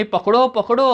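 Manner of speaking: shouting. A narrator's reading. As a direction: call out to catch him. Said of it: "असं ओरडत असते". (खिडकीतून ओरडणारी तरुणी)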